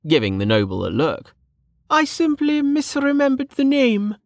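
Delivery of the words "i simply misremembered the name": high-pitched, haughty excuse; high, sniffy aristocrat voice; sniffy noblewoman. Acted. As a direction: brush it off like an offended lady. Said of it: "Lady Parr said with a sniff".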